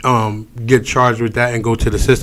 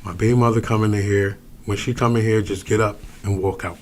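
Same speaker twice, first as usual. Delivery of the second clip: discreet warning; 4 semitones lower, 9 dB quieter than usual. Retold or imitated. Retells a discreet warning to companion.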